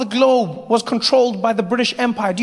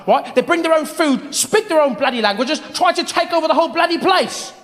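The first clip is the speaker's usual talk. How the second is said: put-on light British accent, ranting; mock a grumbling British bigot; imitating bigoted Brit; 2 semitones higher than usual. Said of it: "people saying the weirdest things".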